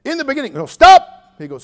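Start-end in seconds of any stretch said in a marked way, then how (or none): 0.0-0.5 high quick reading; eager young reader. Retold or imitated. Young preacher reads Genesis to his mentor, eagerly. 0.5-1.1 loud high stop; sharp interruption. Retold or imitated. Old preacher cuts off the young one, sharply.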